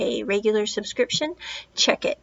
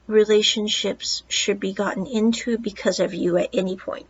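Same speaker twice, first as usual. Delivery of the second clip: normal talking voice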